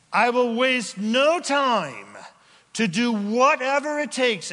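preacher voicing the repentant's vow, high and loud; loud proclaiming. Real, not acted.

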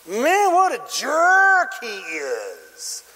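very slow, swooping "what a jerk"; exaggerated indignation; indignant onlooker. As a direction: draw it out high in mock outrage.

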